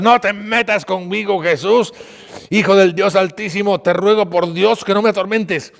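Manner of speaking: high, loud cry of protest; shouting. A narrator's reading. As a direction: cry out loudly, begging to be left alone. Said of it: "le dijo a gritos".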